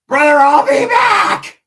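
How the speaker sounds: very high, loud, slow put-on shout; hammed-up Wood voice. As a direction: belt it out like a goofy tough guy.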